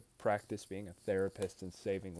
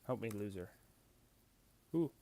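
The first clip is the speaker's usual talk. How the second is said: ordinary talk, nothing marked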